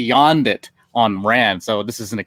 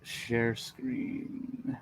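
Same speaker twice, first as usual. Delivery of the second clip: very soft, absent-minded murmur; muttering to self; 14 dB quieter than usual. Real, not acted. Presenter searching for screen share control, muttering to self. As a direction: murmur it while hunting for the button. Reading button aloud to self.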